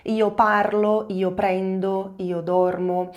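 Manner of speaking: slow, deliberate conjugation drill; careful teacher drill. Shown as a demonstration. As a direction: spell out each form slowly. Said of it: "Io termina sempre com a letra O".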